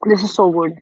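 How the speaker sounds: talking through a mouthful of noodles; mouth full. Real, not acted. Host eating noodles on stream, mouth full. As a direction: say it while still chewing. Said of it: "I'm just gonna take another bite".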